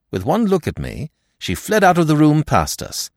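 ordinary delivery

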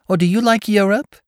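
high, loud sudden gush; gushing exclamation; exclaiming. A narrator's reading. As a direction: burst out eager and high. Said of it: "she exclaimed surprisingly".

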